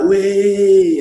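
high, loud, drawn-out crowd cheer; stretched-out mocking "wee"; jeering crowd. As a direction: mimic gleeful fans jeering. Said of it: "Preston fans are there like".

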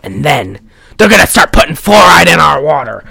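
mock paranoid rant, punched out; lunatic conspiracy theorist. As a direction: rail like a crazed wrestling promo. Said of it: "that's exactly how he sounded".

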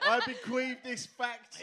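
slow, loud, high solemn proclamation; pompous will reading. Acted. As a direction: read it out like a grand last testament.

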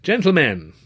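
loud call for attention. A narrator's reading. Master of ceremonies calls to the crowd. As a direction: call the room to order. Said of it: "in a more conversational tone".

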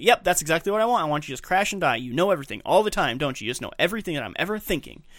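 high, loud mock agreement; sarcastic retort. Wife answering drunk husband's accusation, sarcastic. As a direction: throw it back at him, pitch jumping up. Wife snaps back in retold quarrel.

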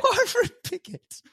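high mocking disbelief; incredulous repeat. Real, not acted. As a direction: repeat the name in shock. Mocking teammate's guess.

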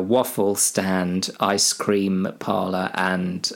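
ordinary talk, nothing marked

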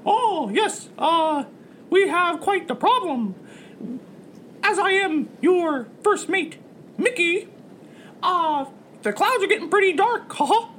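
high, polite mascot voice; cartoon mouse first mate. First mate reports dark clouds to captain, high cartoon mouse. Plays Mickey the rat.

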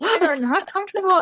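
high, loud sarcastic echo; mock their delicate feelings; sarcastic high singsong; mock sympathy. Echoing mock of researchers.

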